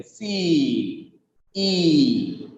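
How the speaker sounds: long, held "C", then "E" alone; drawn-out vowel sound. Shown as a demonstration. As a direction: stretch the ee in "C" and "E" to isolate it. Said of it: "E sound is there".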